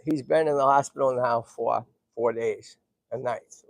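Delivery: ordinary delivery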